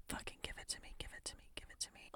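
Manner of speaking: fast breathless whispered pleading; hurried urgent whisper; rushed whisper. Acted. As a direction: tumble the words out in a rush.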